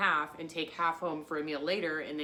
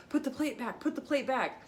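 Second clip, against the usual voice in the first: higher-pitched hurried repeat, urging; urgent plea; 6 semitones higher than usual. Retold or imitated. Urging Molly at the restaurant to put the plate back, higher-pitched and urgent. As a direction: press her to put it back quickly.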